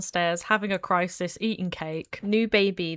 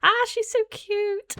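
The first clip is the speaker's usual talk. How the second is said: cooing; 12 semitones higher than usual. Real, not acted. Cooing over baby.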